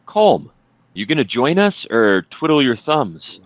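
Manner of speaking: high, loud call across the battlefield; in-character yell. Acted. Player yelling at an absent ally as a free action, shouted. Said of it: "I'm gonna yell at Calm".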